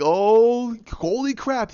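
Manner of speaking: high, excited fan reaction; imitated amazed viewer. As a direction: gush it like a stunned fan. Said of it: "and you're like".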